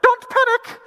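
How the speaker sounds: very high, loud squawked warning; imitating a guide yelling over the bus mic; mock high-pitched alarm; tour guide on mic. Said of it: "get on the mic and she'd go".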